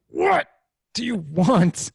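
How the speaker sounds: hammed-up yell of a fed-up parent; mock exasperated yell. Tired dad's inner answer to his son, mock exasperated yell.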